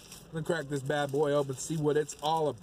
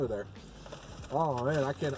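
speaking mid-bite attempt; talking with burger at mouth. Real, not acted. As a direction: mouth around food. Seen 0: Speaker holds the burger at his open mouth until 0.8s, then lowers it.

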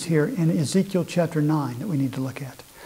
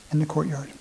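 In the courtyard repeated much softer; 7 dB quieter than usual. Shown as a demonstration. Preacher quietly repeats the answer to the congregation. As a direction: echo In the courtyard quietly to let it sink in. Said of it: "repeated answer".

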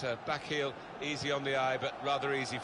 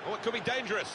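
higher, urgent match call; rising excitement; 6 semitones higher, faster than usual. Acted. Commentator spotting a dangerous attack, excited. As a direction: raise the alarm at a threatening attack.

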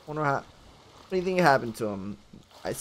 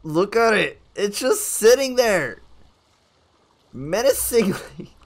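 louder, higher, slow emphatic complaint; mock indignation; 5 semitones higher, 6 dB louder than usual. Player complaining about a statue just sitting there, loud mock outrage. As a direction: draw it out loudly, pitched up.

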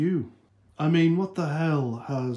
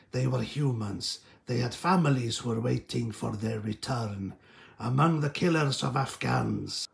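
Bond villain voice; 2 semitones lower than usual. Retold or imitated. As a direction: sneer it out like a Bond-type villain. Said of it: "classical Bond-type villains".

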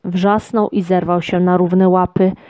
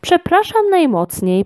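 much higher, embarrassed sorry; flustered apology; 10 semitones higher than usual. A narrator's reading. Lucjan says sorry to the gnome, higher and flustered. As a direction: apologise in mild confusion. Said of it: "powiedział zmieszany Lucjan".

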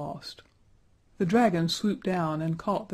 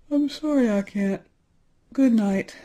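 louder, higher sleepy brush-off; drowsy refusal; 5 semitones higher, 7 dB louder than usual. A narrator's reading. Saint George dismissing the children, louder and drowsy. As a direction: wave them off and drop back to sleep.

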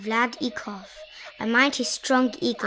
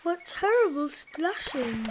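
higher-pitched, mildly dismayed elephant; frowning dismay; 5 semitones higher than usual. A narrator's reading. Elephant troubled by the splashing, mild frowning dismay. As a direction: fret lightly at the noise. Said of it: "the elephant frowned".

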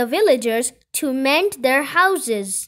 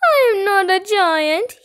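high, loud tearful protest; crying; 6 semitones higher, 5 dB louder than usual. A narrator's reading. Kipper protests to villagers, crying. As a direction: wail it as a crying little boy. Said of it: "Kipper began to cry".

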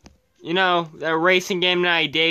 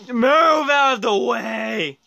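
long drawn-out yell; slow, stretched shout; impatient shout; 3 semitones higher, slower than usual. Real, not acted. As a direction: yell at the car blocking you. Shouting at car ahead.

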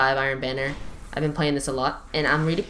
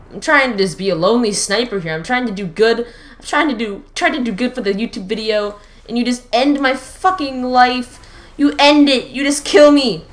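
higher, louder complaining; whiny rant; 7 semitones higher, 7 dB louder than usual. Real, not acted. Sniper complaining to the enemy, loud whiny rant. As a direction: rant at the player who killed him.

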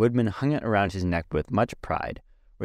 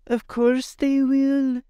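put-on much higher, slow boy's voice; Tip's voice; much slower than usual. A narrator's reading. Tip explaining to Jack, high and slow. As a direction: pitch up high and draw it out. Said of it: "said Tip".

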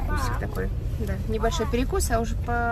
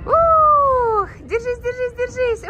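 high, loud thrilled cheer; excited; 13 semitones higher, 8 dB louder, much slower than usual. Real, not acted. Child on a ride, mother cheers.

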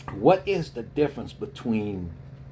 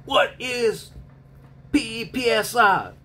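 higher, louder mock yell; drill sergeant bark; 12 semitones higher, 4 dB louder than usual. Drill sergeant quizzing a private, mock yell. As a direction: spell it out like a barked drill question. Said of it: "he said".

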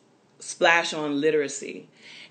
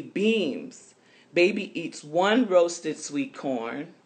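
lively storybook read-aloud for little ones; reading to children; 3 semitones higher, 4 dB louder than usual. A narrator's reading. Reading a picture book to listening children, lively storytelling voice. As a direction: read it brightly, like story time.

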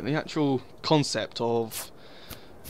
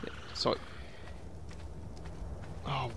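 ordinary delivery

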